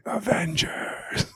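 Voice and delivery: breathy unvoiced whisper, slow; hushed, drawn-out whisper of the name. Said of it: "there was this little whisper of going".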